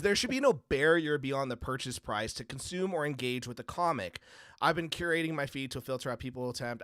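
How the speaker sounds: normal talking voice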